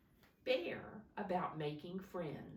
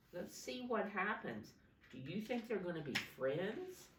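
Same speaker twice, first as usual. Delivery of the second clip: usual speaking voice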